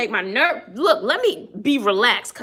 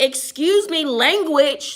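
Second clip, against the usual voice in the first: raised-pitch teacherly reprimand; pitched-up, pointed retold correction; mock scolding; 5 semitones higher, 4 dB louder, slower than usual. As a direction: imitate a teacher calling out bad language. Retells correcting a child's profanity.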